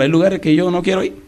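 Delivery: ordinary talk, nothing marked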